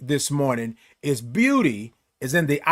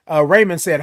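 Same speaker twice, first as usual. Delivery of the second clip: ordinary delivery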